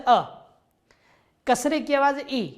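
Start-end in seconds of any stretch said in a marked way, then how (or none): 0.1-0.2 brief Arabic fatha "a" on آ; short fatha sound. Shown as a demonstration. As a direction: say آ as a short "a", not stretched. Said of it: "فتحے کی آواز ہے".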